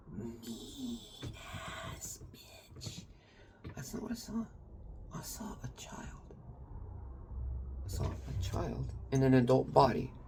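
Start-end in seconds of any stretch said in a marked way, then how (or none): 0.1-4.4 whispered. Real, not acted. Speaker drops voice mid-rant. 5.1-6.3 breathy hush with no voice; whispered. Real, not acted. Telling a friend about a hurting loved one, in a hushed whisper. Whispered, then repeated aloud.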